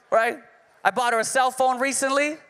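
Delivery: ordinary talk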